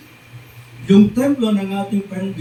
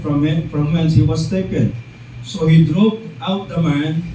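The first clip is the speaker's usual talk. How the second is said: lower, measured Bible reading voice; scripture reading; 5 semitones lower than usual. Acted. Preacher reading Genesis to his congregation, lower and solemn.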